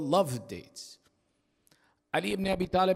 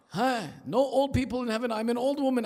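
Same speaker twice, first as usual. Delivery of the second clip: a higher-pitched, lightly voiced old woman in dismay; upset old lady; 7 semitones higher than usual. Preacher voicing the tearful old lady leaving the mosque, mildly distressed and higher. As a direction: play the old lady hurt and confused, a touch higher. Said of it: "The lady leaves the masjid crying".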